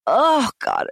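high, appalled exclamation; a sharp burst of dismay, pitched high; disgusted dismay. Real, not acted. Recoils recalling intruder's hands at window.

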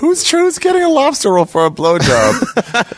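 incredulous high mock question.

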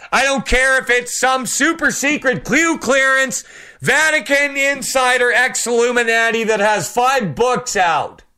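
high-pitched preacher's call to the patriots; fiery preaching. Acted. Preaching at full force.

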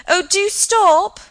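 high, drawn-out shout. A narrator's reading. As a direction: shout down the street after someone.

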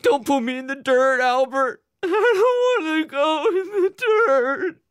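very high, loud wailing plea; hysterical crying shout. A narrator's reading. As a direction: bawl it out uncontrollably. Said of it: "crying uncontrollably ... he cried".

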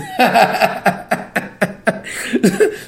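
long, voiceless cackle of amusement; helpless real laugh at the dialogue. Real, not acted. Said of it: "I just be laughing".

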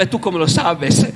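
normal talking voice

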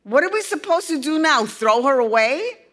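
high, fast snippy retort; sarcastic. A narrator's reading. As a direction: toss it off sarcastically. Girl sharp question.

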